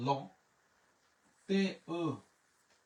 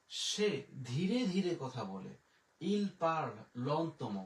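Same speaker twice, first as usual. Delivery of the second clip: drawn-out, measured example sentence; slow, deliberate; much slower than usual. Shown as a demonstration. Teacher models "speaks slowly" for learners, slow and deliberate. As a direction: spell it out slowly, word by word.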